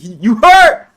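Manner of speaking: high, loud taunting "You hurt?"; mock taunt. To a rival on stream, a loud high mocking taunt. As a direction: sneer it up high like a troll.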